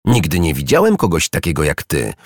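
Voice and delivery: ordinary delivery, nothing marked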